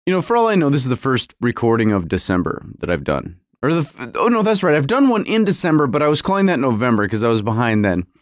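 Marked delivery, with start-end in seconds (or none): none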